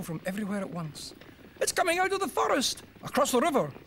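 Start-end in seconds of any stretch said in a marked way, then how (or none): 1.6-3.7 high, loud frantic warnings; panicked shouting. Acted. Villagers fleeing the noise, high frantic shouts.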